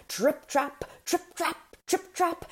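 high, showy hoof beats; grand trotting performance. A narrator's reading. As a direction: trot out TRIP-TRAP with a flourish. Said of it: "he put on the grandest performance yet".

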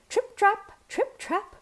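high, light trip-trap; tiny goat's trot. A narrator's reading. Tiny goat trotting over bridge.

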